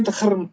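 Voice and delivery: धर्म with the d and kh run together quickly; d-kh blend. Shown as a demonstration. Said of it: "द और ख को मिला के".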